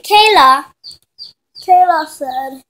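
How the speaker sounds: ordinary delivery, nothing marked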